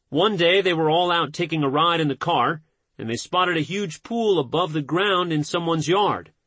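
usual speaking voice

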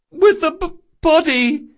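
high, loud stammering cry; tearful outburst. Acted. Boy interrupting about the corpse, high tearful outburst. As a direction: burst out, breaking on the word. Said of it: "Locke burst out".

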